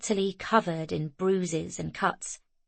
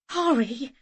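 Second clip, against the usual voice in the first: shaky; 6 semitones higher than usual. A narrator's reading.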